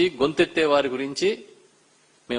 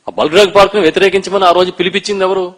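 loud, higher, pressing who called them; angry demanding questions; 3 semitones higher, 8 dB louder than usual. Real, not acted. Opposition leader challenging the minister, loud angry questions. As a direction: demand an answer, voice rising in anger.

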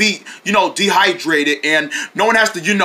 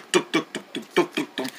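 knocking; 6 semitones lower, 10 dB quieter than usual. Acted. Imitated knocking noise.

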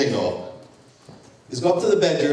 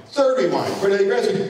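usual speaking voice

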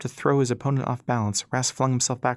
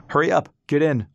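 loud, higher-pitched hurry-up shout; urgent call; 4 semitones higher, 6 dB louder than usual. A narrator's reading. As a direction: call out urgently to get them aboard. Brother urgently calls from arriving ship.

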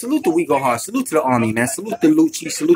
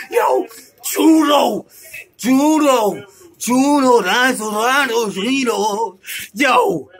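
high, slow shout of "judo"; hyped shouting; 6 semitones higher, much slower than usual. Real, not acted. Reacting to a bar, a slow hyped shout. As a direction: chant it like a crowd hype man.

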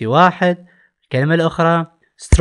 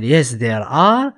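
very slow, clear English; slow model answer; much slower than usual. Shown as a demonstration. Teacher models the answer for the class, very slow and clear. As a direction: spell it out word by word.

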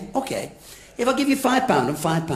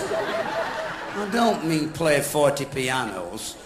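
slow exasperated correction; 6 semitones lower, 5 dB quieter, much slower than usual. Real, not acted. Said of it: "I said pianoforte".

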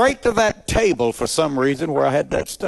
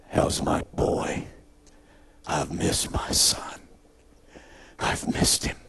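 hushed, slow, tender father's words; tender whisper; 7 semitones lower, 7 dB quieter, slower than usual. Retold or imitated. Preacher softly voicing his father's welcome to his runaway son. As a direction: breathe it softly like a loving father.

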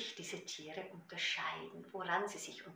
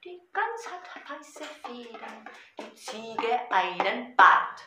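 Gedichtvortrag; 11 dB louder, slower than usual. Shown as a demonstration. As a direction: recite to a drumbeat, easing down to a hushed ending. Recites poem with drum. (rhythmischer Gedichtvortrag, am Ende leise)

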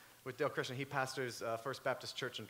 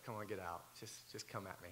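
low, soft mock challenge, much lower; old nature taunt; 8 semitones lower, 12 dB quieter than usual. Preacher voicing his old self to a bully, low and soft. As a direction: mutter the dare under your breath. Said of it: "the old nature, the old man, [name], is like".

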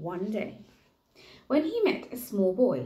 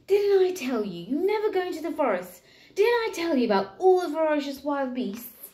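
higher, louder telling-off, quick in the middle; scolding mum; 8 semitones higher, 5 dB louder than usual. Acted. Mum telling off Jack, loud and exasperated.

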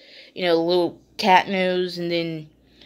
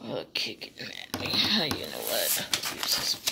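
whispering; 12 semitones lower, 8 dB quieter than usual. Real, not acted. Aside while handling items.

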